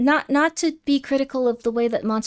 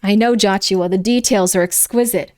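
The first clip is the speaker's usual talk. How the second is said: ordinary talk, nothing marked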